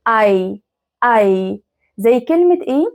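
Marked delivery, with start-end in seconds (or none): 0.1-1.6 "أي" as one long eye sound; German ei diphthong. Shown as a demonstration. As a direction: glide "أي" from ah to ee, lower pitch. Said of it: "لما يجوا مع بعض بيتنطقوا".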